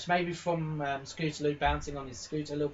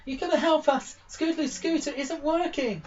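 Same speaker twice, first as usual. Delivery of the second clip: much higher, louder, urgent cry for help; panicked filly begging for help; breathless plea; 12 semitones higher, 4 dB louder than usual. Acted. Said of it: "she's all huffing and puffing".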